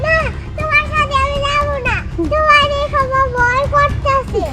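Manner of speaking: put-on scared child. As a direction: pull back from the stranger in fear. Frightened child refusing.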